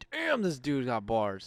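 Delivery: slow, drawn-out impressed remark. Retold or imitated. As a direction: savour each word, amazed. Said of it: "and I was like".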